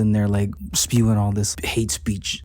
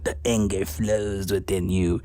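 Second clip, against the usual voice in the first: dark villain voice; slower than usual. Retold or imitated. Villain tempting Ross to give in, slow sinister voice. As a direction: drawl it like a Sith lord tempting.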